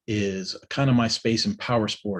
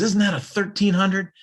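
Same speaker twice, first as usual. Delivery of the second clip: put-on much higher, keen young voice; eager kid; 11 semitones higher, 3 dB louder than usual. Young rider eagerly asking about the bike, pitched way up. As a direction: ask it all lit up.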